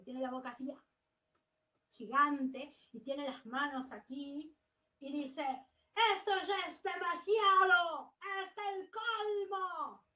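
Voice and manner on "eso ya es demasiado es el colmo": loud, high shouted line; shouting. Shown as a demonstration. Teacher acting out Mafalda's furious shout. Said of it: "Está gritando".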